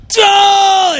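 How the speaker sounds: high, drawn-out "duh!" cry; long high burst, struck mid-sentence; jolted cry. Retold or imitated. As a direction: re-enact a cry under overwhelming power. Acts out groaning in travail.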